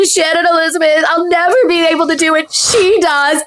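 high, hammed-up weepy voice; mock tearful outburst. Contestant making up an excuse to partner, mock tearful. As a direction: imitate a crying wail pitched well up. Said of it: "I started crying".

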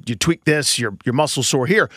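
ordinary delivery

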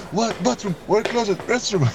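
desperate foreigner. Retold or imitated. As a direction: squeak out the English words in mock panic. Friend frantically asking for toilet.

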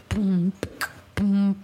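spoken beat, evenly spaced booms; beatbox boom. Pretend. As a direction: lay down a beat with your mouth. Beatboxing for requested freestyle.

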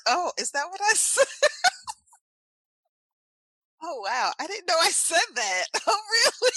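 high-pitched, cracking up in surprise; real laughing disbelief. Real, not acted. Guest hearing how host mimics her, laughing in disbelief. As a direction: squeal it through helpless real laughter. Teased about her own accent.

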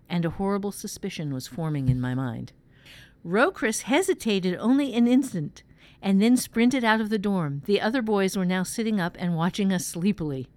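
measured first-person story reading; dramatic audiobook narration with voiced dialogue. A narrator's reading. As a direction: read a tense night-time tale aloud. Reads fiction aloud.

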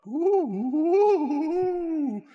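high, laughing "ooh"; high eerie owl hoot with a laugh; spooky hooting. Acted. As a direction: hoot it high like a mock ghost. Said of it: "you hear a".

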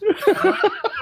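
a squeaky high laugh; high giggle. Real, not acted. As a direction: burst into a high-pitched giggle. Laughs amid his mock lecture.

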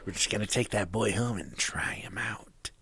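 whispered, sinister captain; soft creepy whispered line. Pretend. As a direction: breathe it out like a creep.

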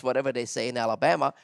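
ordinary talk, nothing marked

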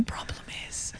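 very soft, quick whisper. Retold or imitated. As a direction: whisper so it is barely heard. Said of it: "speaks in whispers".

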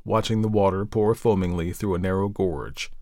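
ordinary delivery, nothing marked

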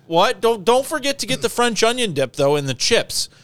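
high-pitched mock wife reminder; imitated wife on phone. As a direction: mimic the wife nagging about the shopping. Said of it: "And she's like".

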